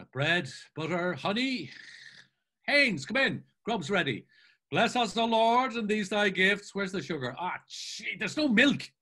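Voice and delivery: high, animated character chatter; bustling Mulligan. Acted. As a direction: call out briskly, rattle off a mock grace, then exasperation. Mulligan bustles over breakfast, mock grace.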